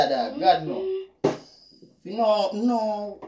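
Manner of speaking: ordinary talk, nothing marked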